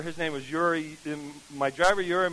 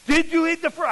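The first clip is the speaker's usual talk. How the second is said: much higher, louder, wide-eyed question; stunned disbelief; 9 semitones higher, 5 dB louder than usual. Retold or imitated. Lady stunned the preacher survived her fruit, high disbelief. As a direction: ask it like you can't believe he's alive.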